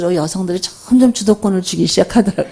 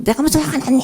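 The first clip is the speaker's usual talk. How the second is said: snapping back much higher, indignant; mock angry denial; 9 semitones higher, faster than usual. Mother snapping at her child, mock indignant. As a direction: deny being angry while clearly angry. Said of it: "아니라고 막 화를 내요".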